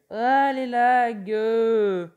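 un ah très étiré, aigu et très fort; traîne le cri de frustration; plainte criée. Real, not acted. Plainte exagérée contre le lag. (cri étiré contre les lags)

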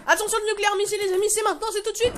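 tense, high countdown-style call; excited warning. Real, not acted. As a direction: announce it urgently, pitched right up. Counts viewers into nuclear blast.

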